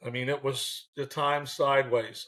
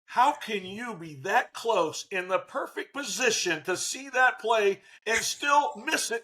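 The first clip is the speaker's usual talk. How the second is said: slow, raised-pitch disbelief at a bad call; incredulous complaint; 6 semitones higher, slower than usual. Shown as a demonstration. Manager berating umpire over a missed call, incredulous. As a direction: question the umpire, stretching each word.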